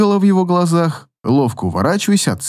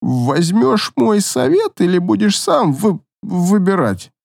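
put-on slow, careful drunk diction; a drunk trying to sound sober; overly precise; 5 semitones higher, slower than usual. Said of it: "Искусственно чётко выговорил мастер".

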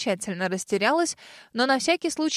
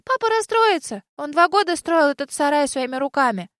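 put-on little girl voice; 5 semitones higher, 4 dB louder than usual. A narrator's reading.

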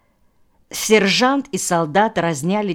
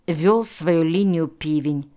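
ordinary delivery, nothing marked

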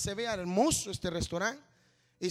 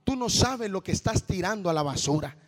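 loud, rising preacher's climax; preaching at full force; 10 dB louder than usual. Acted. Preacher telling quitters they serve God, not the pastor, in loud preaching. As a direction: build and shout it out.

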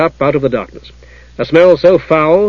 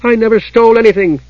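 high-pitched tearful indignation, acted; growing heated; 8 semitones higher, 3 dB louder than usual. A narrator's reading. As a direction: protest hotly, voice lifted. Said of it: "growing heated".

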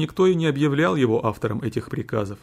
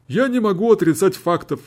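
higher, louder resigned admission; lawyer conceding in court; 8 semitones higher, 5 dB louder than usual. A narrator's reading. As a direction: concede the facts before the court.

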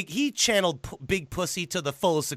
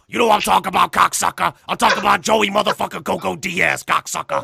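loud, brash comedian impression; Joey Diaz; 5 dB louder than usual. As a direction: imitate a foul-mouthed comic, punching it out. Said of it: "Do you know who Joey Diaz is?".

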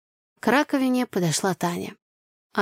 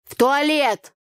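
cawed reply; 7 semitones higher, 4 dB louder, slower than usual. A narrator's reading. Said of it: "каркнула в ответ Танюха".

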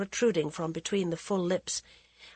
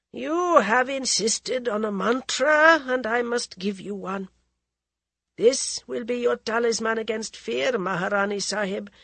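solemn holy woman; 4 semitones higher, 3 dB louder than usual. Acted. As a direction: hand over a charm with weight. Ascetic gives the mantra.

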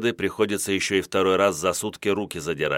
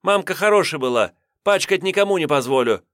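indignant; 8 semitones higher, 4 dB louder than usual. A narrator's reading. Redhead defends mother.

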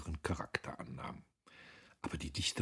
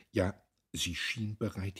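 usual speaking voice

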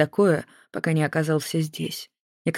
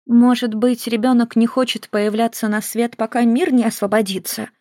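lightly voiced companion, higher; a higher woman's voice asking gently; gentle woman's voice; 6 semitones higher, 3 dB louder than usual. A narrator's reading. Child-soothing woman voiced.

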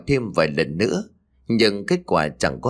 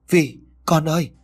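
anguished cry; 6 semitones higher than usual. A narrator's reading. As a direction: cry her name out in the dream. Mother cries out to daughter.

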